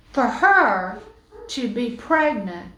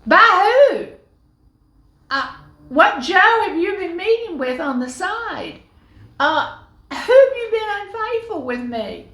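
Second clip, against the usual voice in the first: much higher and louder, shocked accusation; shocked outrage; 8 semitones higher, 6 dB louder than usual. Acted. Joseph to pregnant Mary, shocked and loud. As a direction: burst out in disbelief. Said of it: "his first response was".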